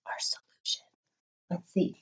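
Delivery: secret hint whisper; hushed conspiratorial teacher. Acted. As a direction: lean in and whisper the clue.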